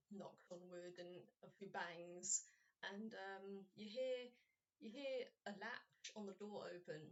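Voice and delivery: normal talking voice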